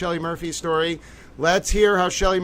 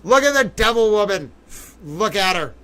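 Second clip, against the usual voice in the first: gloating taunt; 4 semitones higher, 4 dB louder than usual. Real, not acted. Gloating at photo.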